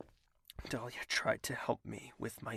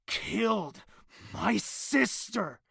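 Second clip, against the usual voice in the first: angry shout; 7 semitones higher, 5 dB louder, much slower than usual. Acted. Heated argument, defending execution.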